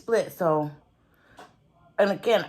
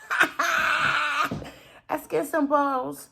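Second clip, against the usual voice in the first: laughing; 4 semitones higher, much slower than usual. Real, not acted. Creator amused by own skinned balls joke, laughing.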